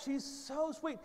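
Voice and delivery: ordinary delivery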